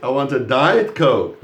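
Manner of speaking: Diet punched loud and drawn out in a slow sentence; stress on Diet. Shown as a demonstration. Teacher confirms a student's answer, stressing Diet loudly. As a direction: slowly, hit Diet hard, let Coke drop. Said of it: "So you stress the word diet".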